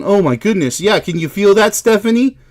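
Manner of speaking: normal talking voice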